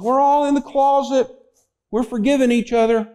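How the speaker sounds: lightly voiced child calling from the closet; little kid voice. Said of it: "they hear this little voice".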